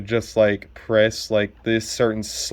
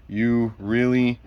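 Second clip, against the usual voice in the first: ordinary talk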